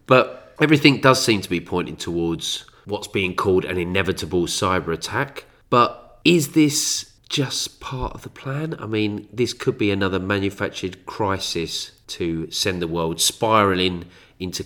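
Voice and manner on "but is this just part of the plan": slow, high ominous question. Acted. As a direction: drag out the question with menace.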